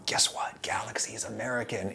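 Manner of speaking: conspiratorial whisper. Real, not acted. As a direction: lean in and whisper the secret. Reveals a secret about chocolate.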